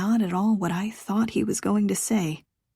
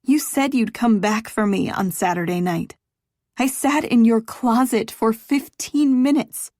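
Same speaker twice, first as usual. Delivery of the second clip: growing higher and louder toward a shout; building anger; 5 semitones higher, 7 dB louder than usual. Acted. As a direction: let the hurt boil over into yelling. She reminds him he abandoned her.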